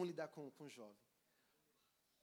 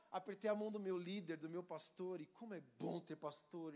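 ordinary talk, nothing marked

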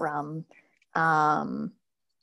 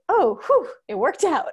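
relieved exclamation; 10 semitones higher, 5 dB louder than usual. Retold or imitated. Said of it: "and I was like".

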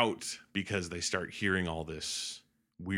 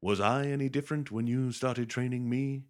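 higher-pitched disembodied mentor voice, put on; Ben; 5 semitones higher than usual. Ghost of Ben answering Yoda, disembodied mentor voice. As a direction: call back as an unseen old master. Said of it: "disembodied voice of Ben calls back".

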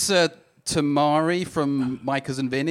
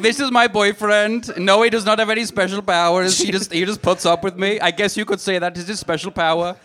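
put-on Indian accent; 2 semitones higher than usual. Miss Marvel introducing her powerless boyfriend, in an Indian accent. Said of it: "this is the Miss Marvel accent".

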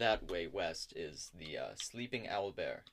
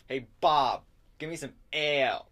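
full-volume, raised-pitch bellow; yelling at an employee; 6 semitones higher, 13 dB louder than usual. Acted. Barkeeper orders ale from his employee, yelling. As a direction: holler for it across the room. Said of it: "he yells at one of his employees".

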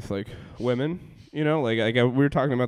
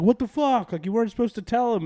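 high, fast, indignant squeal; outraged disbelief; 11 semitones higher than usual. Retold or imitated. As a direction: act the friend who can't believe you told him. Friend outraged the joke was revealed.

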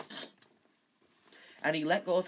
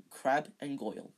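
ordinary delivery

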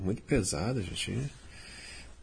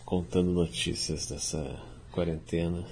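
usual speaking voice

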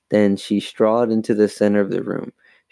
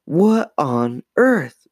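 higher, drawn-out exclamation; shocked cry; 7 semitones higher, 3 dB louder, much slower than usual. A narrator's reading. Mom sees the wrecked kitchen, shocked cry. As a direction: stretch each word in disbelief. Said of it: "Missus Powell cried".

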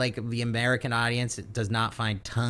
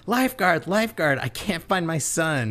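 frantic calling; 6 semitones higher than usual. Retold or imitated. Mother calling the lifeguard for her lost son, mock panic. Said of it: "a woman's like".

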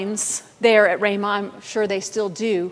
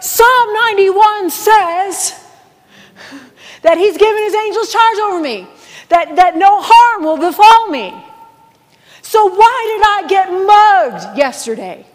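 a mildly indignant caller, a touch raised and fast; retold angry caller; 9 semitones higher, 8 dB louder than usual. Caller on the prayer line demanding answers, mildly indignant. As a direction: press the verse back at him like a grievance. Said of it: "she was mad".

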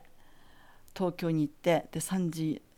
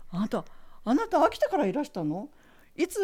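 a touch higher, amazed refined lady; astonished teacher; 7 semitones higher than usual. Retold or imitated. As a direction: imitate her surprise, lightly. Teacher astonished she came from Akita.

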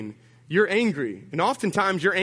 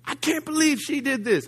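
high, hammed-up outrage; mock indignation; 9 semitones higher than usual. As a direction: gasp out the complaint in mock shock.

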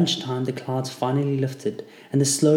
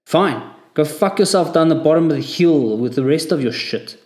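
1 angry retort; 3 semitones higher, 6 dB louder than usual. A narrator's reading. Corporal berates quitting recruit.

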